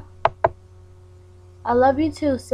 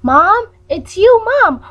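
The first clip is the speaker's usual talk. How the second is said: higher and louder, thrilled to hear mom; excited shout; 7 semitones higher, 7 dB louder, slower than usual. Acted. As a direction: call out mom with joy. Joyful surprise at mom calling.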